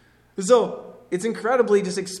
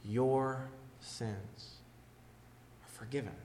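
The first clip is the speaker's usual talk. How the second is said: softly, almost breathed, falling away; hushed; 5 semitones lower, 12 dB quieter, slower than usual. Acted. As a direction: let the promise sink in quietly. Hushed quote of forgiveness.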